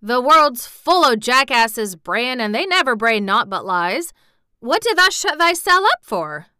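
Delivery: loud indignant country rant; scornful gardener's tirade; old Yorkshire gardener, scornful. Acted. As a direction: rail loudly like an old Yorkshireman. Voices old gardener Ben.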